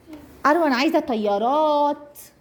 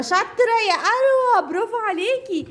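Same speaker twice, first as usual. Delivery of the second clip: high praise; 6 semitones higher than usual. Real, not acted. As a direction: cheer her on in a high voice. Praising a toddler.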